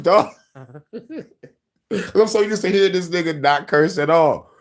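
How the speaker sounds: normal talking voice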